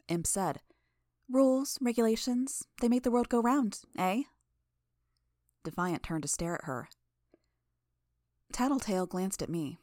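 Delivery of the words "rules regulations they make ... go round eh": higher, louder, mocking cheer; sarcastic Imp. A narrator's reading. Imp teasing Narwhal about order, higher and louder sarcasm. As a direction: oversell it like a joke.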